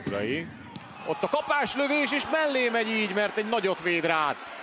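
high-pitched thrilled call; excited commentary. Real, not acted. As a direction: ride the chance up to the save. (izgatott, magas hangú közvetítés)

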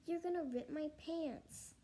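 high-pitched protest, mildly alarmed; crying out. Acted. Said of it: "Jason cried".

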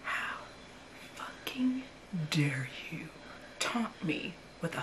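hushed, breathy whisper. Real, not acted. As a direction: hiss it under your breath. Repeats quote in a hush.

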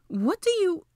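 high, sharply surprised; startled question. A narrator's reading. Hermione startled, cut off.

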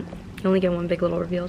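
ordinary talk, nothing marked

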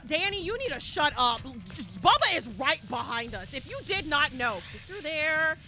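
ordinary talk

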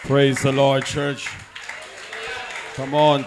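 ordinary talk, nothing marked

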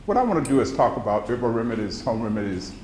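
usual speaking voice

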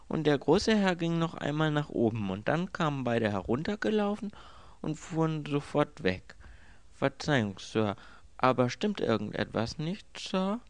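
höher, dienstbeflissen; Diener Rogers. A narrator's reading. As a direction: als eifriger Diener berichten. (Rogers, dienstbeflissener Diener)

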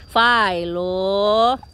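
loud drawn-out call across the house; calling out to the cat. Real, not acted. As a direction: owner calls her cat Philo, loud and long.

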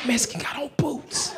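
urgent, voiceless stage whisper; whispered warning. Acted. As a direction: hiss the secret warning under your breath. Secretly passes on the warning.

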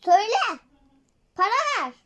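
loud demanding shout; angry demand. Pretend. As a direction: bark for money. Beggar demands money.

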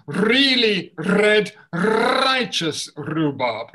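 slow, loud, every r in Really red righteous rhubarb crisp; slow articulation drill; slow clear tongue twister. Shown as a demonstration. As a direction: savour each r slowly.

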